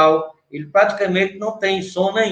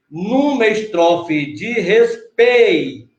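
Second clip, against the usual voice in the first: slow syllable scansion; slower than usual. Shown as a demonstration. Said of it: "o T O você não conta".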